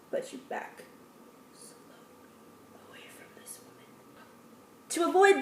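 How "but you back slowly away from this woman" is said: breathy hushed whisper, fading out; whispered backing away. Shown as a demonstration. Backing away from the lioness mom, whispered. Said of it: "you back away".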